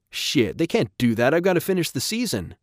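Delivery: high, agitated protest; alarmed protest. Acted. Rob fearing for his baseball season, agitated.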